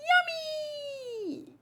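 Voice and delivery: very high squeal of delight; delighted child. Acted. Hungry child given food, high squeal. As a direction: squeak it like a happy kid.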